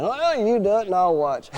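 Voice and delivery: high cheeky dummy comeback; puppet voice. Acted. Dummy Orson talking back to the ventriloquist, high cheeky voice. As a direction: sass the handler in a high voice.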